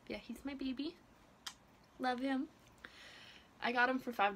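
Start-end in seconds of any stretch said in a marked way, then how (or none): none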